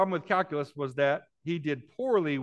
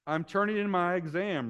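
ordinary delivery

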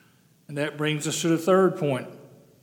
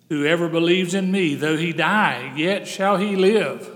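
preach; 2 semitones higher, 5 dB louder than usual. Acted. Preaching scripture aloud.

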